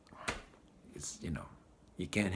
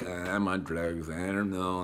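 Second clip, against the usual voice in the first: dazed addict; 5 semitones lower, 3 dB quieter than usual. Retold or imitated. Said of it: "you're like".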